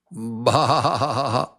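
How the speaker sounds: a silly laughing bleat; laughing mock sheep bleat. Teacher jokingly bleats like a sheep, laughing. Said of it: "that's a terrible sheep".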